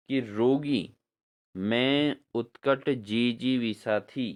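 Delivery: ordinary talk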